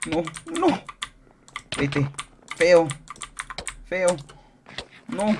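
high loud shriek in the fight; real panicked shriek. Real, not acted. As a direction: scream as you're hit. Fight cries.

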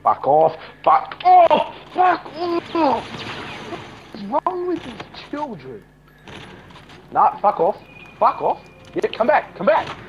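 high, loud shouts; yelling. Real, not acted. Shouting at players chasing him. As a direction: bark it at them.

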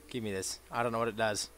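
ordinary talk

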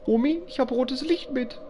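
high, eerie singsong granny; lightly voiced spooky granny; creepy granny voice. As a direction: lure like a creepy old grandma. Streamer voices creepy game grandma.

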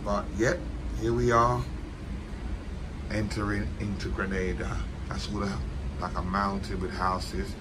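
tired croaky voice, low and quiet; worn-out morning rasp; hungover. Real, not acted. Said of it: "My voice is a bit cronky".

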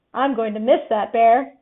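plain reading voice

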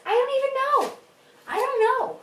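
high whiny I don't know; exasperated. Real, not acted. Can't decide the rating.

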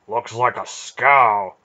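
slow, scornful sneer at the ship; contemptuous. A narrator's reading. Beric sneering at the slave ship, slow and contemptuous. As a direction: spit it out with contempt. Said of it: "his voice heavy with contempt".

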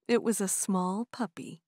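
plain reading voice

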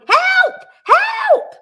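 high, loud shouting for help; a bear's desperate high shout. A narrator's reading. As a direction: yell it out, stuck in the bath. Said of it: "then very loudly".

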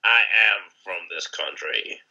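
slow, put-on self-parody; exaggerated slow parody of how you sound; mocking own voice. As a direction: send up your own voice, drawn out. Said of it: "and mine's like".

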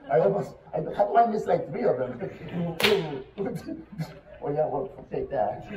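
normal talking voice

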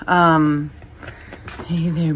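ordinary talk, nothing marked

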